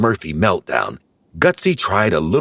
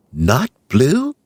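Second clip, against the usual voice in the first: wondering; 8 semitones higher than usual. A narrator's reading. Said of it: "Smurfette wondered".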